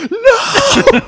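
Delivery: high, loud comic wail of dread; mock horrified scream. Clerk left alone at register with dreaded customer, mock scream. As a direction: scream it way up high like you've been abandoned.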